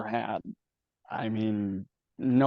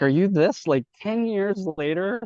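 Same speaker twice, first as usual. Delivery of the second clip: higher-pitched, incredulous retold question; retold incredulity; 8 semitones higher than usual. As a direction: retell it as a disbelieving question to an ex. Retelling his incredulous reply to her.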